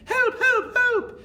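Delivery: very high, loud mock panic; hammed-up high cries for help; yelling for help. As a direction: shriek like a teacher scared of mice. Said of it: "holding up skirts and yelling".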